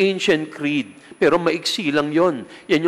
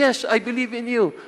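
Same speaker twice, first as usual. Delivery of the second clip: put-on high, eager little child; lightly voiced small child; child's voice; 8 semitones higher than usual. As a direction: answer as a trusting child. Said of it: "Merong isang bata, sabi".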